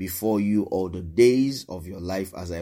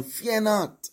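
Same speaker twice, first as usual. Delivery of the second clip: high proclaimed command; 12 semitones higher than usual. Acted. Joshua's command read aloud.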